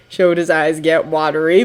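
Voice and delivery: ordinary delivery, nothing marked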